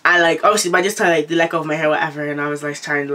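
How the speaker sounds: ordinary talk, nothing marked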